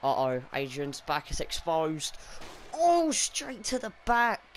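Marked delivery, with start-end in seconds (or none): 2.7-4.4 excited commentator. Real, not acted. Commentator reacting to a hit.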